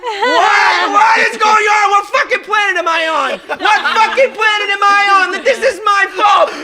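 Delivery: very high, loud yelling; incredulous outrage. Retold or imitated. Driver blamed for the crash, incredulous retold yelling. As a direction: re-enact it as a shrieked protest. Retelling disbelief at being blamed.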